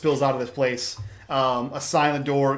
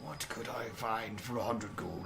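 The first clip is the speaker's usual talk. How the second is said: low, soft grumbling mutter; 3 semitones lower, 7 dB quieter than usual. Acted. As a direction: mutter it over your shoulder. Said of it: "muttering over his shoulder".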